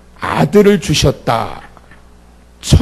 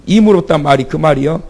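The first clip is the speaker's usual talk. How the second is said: ordinary delivery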